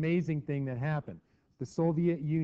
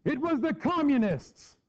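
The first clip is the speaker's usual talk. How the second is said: loud, high, slow accusing cry; Hitler blaming; 12 semitones higher, 5 dB louder than usual. Retold or imitated. Hitler blaming communists to the people, mock loud accusation. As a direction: proclaim it to the crowd as a hammed-up dictator. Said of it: "Hitler came out the next morning going".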